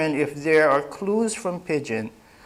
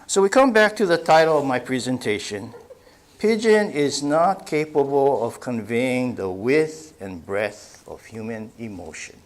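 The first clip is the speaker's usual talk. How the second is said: ordinary talk, nothing marked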